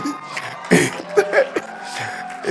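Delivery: airy giggle, high; high breathy laugh. Real, not acted. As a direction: laugh lightly at your own joke. Laugh at own joke.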